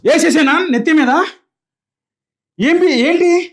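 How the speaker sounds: husband loses his temper; angry snap. Acted. Husband snaps in quarrel.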